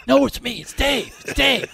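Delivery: high stoner voice, insisting. Retold or imitated. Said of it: "The stoner voice is an easy one to do".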